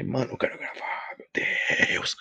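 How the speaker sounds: exasperated whisper. Retold or imitated. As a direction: whisper it, fed up.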